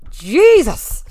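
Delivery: loud high burst of surprise; exclamation. Real, not acted. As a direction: blurt it out in disbelief. Exclaims after the climb.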